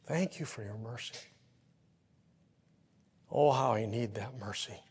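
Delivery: soft, hushed prayer of thanks; congregant's soft thankful prayer; quiet prayer. Pretend. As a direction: murmur it like a private prayer. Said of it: "you're going".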